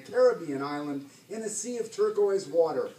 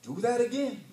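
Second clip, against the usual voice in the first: higher-pitched command; ordering; 7 semitones higher than usual. A narrator's reading. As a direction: give it as an order. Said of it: "he ordered".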